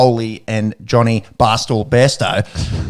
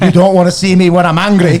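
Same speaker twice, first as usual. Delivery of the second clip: higher, louder mock-Scottish Hulk warning; accidental Scottish accent; 5 semitones higher, 4 dB louder than usual. Quoting the Hulk as Johnny Bairstow, in jokey Scottish accent. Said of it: "I don't know why he's Scottish".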